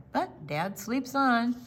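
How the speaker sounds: usual speaking voice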